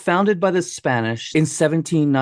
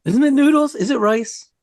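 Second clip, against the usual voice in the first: surprised; 14 semitones higher, 3 dB louder, faster than usual. Real, not acted. Doubting noodles versus rice.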